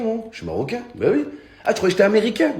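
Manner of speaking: mock fake Moroccan.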